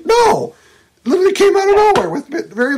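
high, loud, fast outburst; shouted no. Real, not acted. Answering a hypothetical objection, high loud fast outburst. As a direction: burst out in protest.

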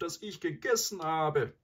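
high-pitched, self-pitying wail; lamenting. A narrator's reading. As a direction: bemoan your own fate in a high voice. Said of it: "klagte er weiter".

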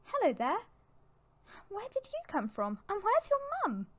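high, gentle coaxing of lost kits; kindly high-pitched questioning; reassuring. A narrator's reading. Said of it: "She spoke in a reassuring voice".

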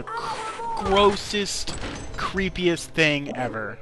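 high, slow, drawn-out disgust. Real, not acted. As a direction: stretch it out, utterly grossed out. Disgusted rant about spiders.

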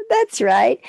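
ordinary delivery

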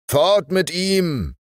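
hoher, langsamer gemeinsamer Ruf; Ruf der Offiziere. Acted. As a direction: im Chor fordernd rufen. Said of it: "riefen die Offiziere wie aus einem Munde". (Offiziere fordern, hoher langsamer Ruf)

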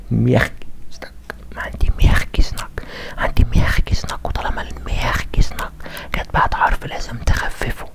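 whispered explanation. Real, not acted. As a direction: drop to an unvoiced whisper. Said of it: "explaining the soft sign".

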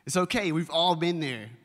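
ordinary talk

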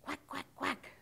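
short imitated quacks; storybook duck noises. A narrator's reading. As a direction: quack like a duck, three quick calls. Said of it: "called the duck".

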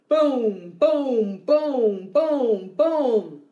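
booming drumbeats, each one falling; low drum voice. Shown as a demonstration. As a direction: boom steadily like a drum. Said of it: "Low voice sounds like a drum".

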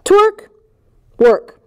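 loud jokey rhyme. Pretend.

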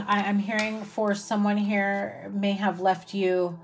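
ordinary talk, nothing marked

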